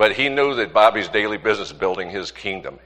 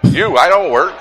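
laughing joke; 8 semitones higher, 9 dB louder than usual. Real, not acted. Pastor jokes about himself.

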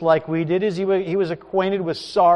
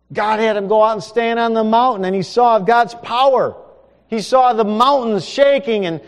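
higher, louder preaching with rising fervour; full-force preaching; 8 semitones higher, 8 dB louder than usual. Acted. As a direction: build the vision of power.

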